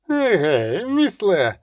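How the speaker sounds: hailing a companion; lightly voiced holler; calling out. A narrator's reading. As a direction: call out to a mate. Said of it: "крикнув Келі".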